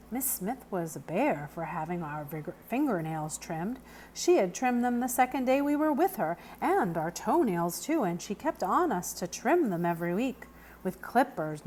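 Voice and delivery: ordinary delivery, nothing marked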